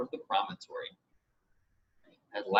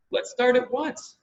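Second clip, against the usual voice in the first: put-on higher and louder, impatient boy; a boy who can't wait a second longer; eager Roger; 11 semitones higher, 4 dB louder than usual. To the others, Roger urges leaving now, eager and high.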